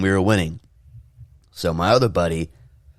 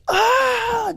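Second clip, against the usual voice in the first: very high imitated pain scream; mock scream. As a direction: yelp like the crushed teammate. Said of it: "just, like, screaming".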